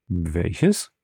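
low-pitched astonished question; mildly astonished, voice dropped then rising. A narrator's reading. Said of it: "fragte der Graf erstaunt". (erstaunt nachgefragt)

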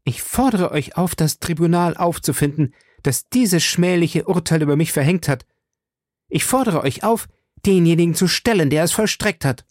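high, indignant woman's voice; indignant, insistent demand building in force; defiant challenge. A narrator's reading. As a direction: throw the demand at your accusers, pitched high. Accused Milady defiantly challenges her accusers.

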